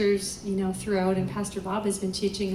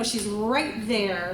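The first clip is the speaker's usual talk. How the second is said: ordinary talk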